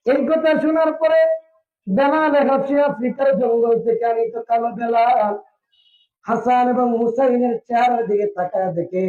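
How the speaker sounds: high chanted sermon narration; melodic preaching. A narrator's reading. Preacher telling of Bilal facing Hasan and Hussain, high chanted preaching.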